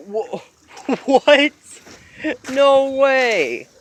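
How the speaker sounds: real amused astonishment; laughing disbelief. Real, not acted.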